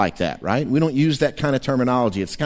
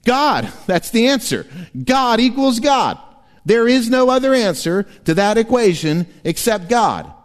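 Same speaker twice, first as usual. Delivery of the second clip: high, raised preaching voice; preaching at full force; 10 semitones higher, 3 dB louder than usual. Real, not acted. Pastor proclaims the answer to the congregation, forcefully. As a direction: drive it home from the pulpit.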